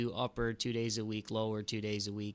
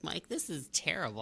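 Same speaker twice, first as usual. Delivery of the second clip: mom voice; 6 semitones higher than usual. Retold or imitated.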